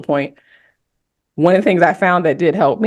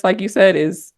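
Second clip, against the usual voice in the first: ordinary talk, nothing marked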